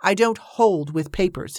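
ordinary delivery, nothing marked

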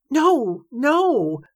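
high, drawn-out double no; flustered denial. A narrator's reading. Blushing riverman corrects Evangeline's assumption, flustered. A flush spread across.

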